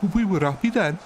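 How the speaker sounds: high-pitched comic Brummie; Birmingham accent. Shown as a demonstration. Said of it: "you could say that in a Birmingham accent".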